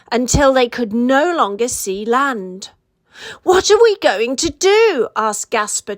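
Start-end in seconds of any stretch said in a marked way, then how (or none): 3.4-5.1 trembling. A narrator's reading.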